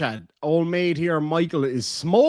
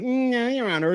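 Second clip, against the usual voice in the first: higher-pitched smug mimicry; mock her with a smug, raised voice; 7 semitones higher than usual. Streamer mimicking woman in court being smug, smug. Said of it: "like, smug".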